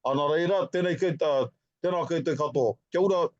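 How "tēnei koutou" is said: ordinary talk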